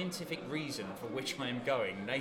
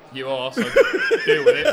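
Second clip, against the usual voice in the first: laughing; 10 semitones higher, 14 dB louder, slower than usual. Real, not acted. Teasing a friend, laughing.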